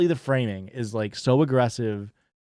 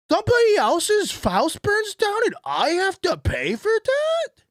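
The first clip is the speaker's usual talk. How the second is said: much higher, hammed-up shocked shouting; mock outrage; slower than usual. Streamer mocking a stingy taxpayer, high mock-outraged shout. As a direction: play a stingy guy aghast at paying.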